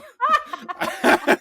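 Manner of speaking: high-pitched burst of laughter; squealing laugh; high giggle. Real, not acted. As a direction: cackle at your own slip. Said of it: "laughs at own slip".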